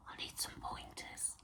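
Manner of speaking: hushed whisper to a friend watching; whispered aside. Real, not acted. To Carla watching her podcast, a hushed whisper. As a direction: whisper it like a secret request.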